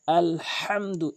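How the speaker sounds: আলহামদু, slow, হা from mid-throat; correct Alhamdu. Shown as a demonstration. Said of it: "এভাবে আমরা উচ্চারণ করব". (আলহামদু ধীরে, হলক থেকে চাপ দিয়ে)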